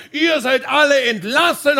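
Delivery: hammed-up yelling; acted shouting. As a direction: shout it like a boss sacking everyone. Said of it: "hat er geschrien".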